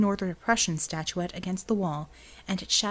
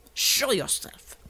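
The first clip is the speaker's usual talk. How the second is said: barked command; 4 semitones higher, much slower than usual. Acted. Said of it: "Untermensch's bark".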